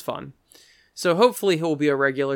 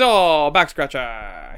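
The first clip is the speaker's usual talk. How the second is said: drawn-out mock letdown; imitated waiter, slow and let down; disappointed waiter; 3 dB louder, much slower than usual. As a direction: sink into a slow, hammy groan of words. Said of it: "He goes".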